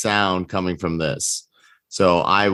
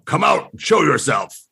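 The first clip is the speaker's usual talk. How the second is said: hobgoblin imitation; 7 semitones higher than usual. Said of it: "great voice acting".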